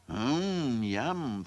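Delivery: soft, savouring hum; thinking to himself. A narrator's reading. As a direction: relish it privately. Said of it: "thought Tom".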